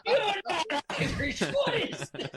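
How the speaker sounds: high, laughing mock outrage; mocking doubters. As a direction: parody people scoffing at his Irishness.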